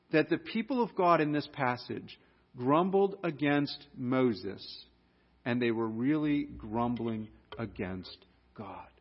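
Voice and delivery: ordinary delivery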